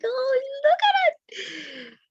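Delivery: very high, slow, melting coo; cooing at a baby. Retold or imitated. Adult cooing at a baby, very high and slow. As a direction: gush over a baby like you might cry.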